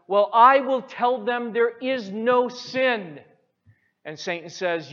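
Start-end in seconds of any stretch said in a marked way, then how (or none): 0.1-3.2 loud, proud boast; a pupil showing off his plan; eager demon. Retold or imitated. Second demon answers Satan.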